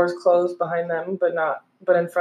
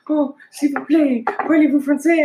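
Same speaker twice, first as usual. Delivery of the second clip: higher, hammed-up French accent; mock French; 7 semitones higher, 3 dB louder than usual. Stranded abroad pleading to a local, mock French accent. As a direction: play a lost tourist begging in French accent. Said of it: "I'm gonna be like".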